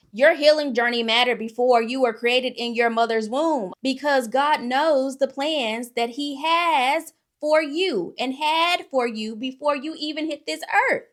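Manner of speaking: loud, rising sermon build; fired-up sermon climax; preaching at full force. Real, not acted. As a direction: preach it to the congregation, slowing on God's plans.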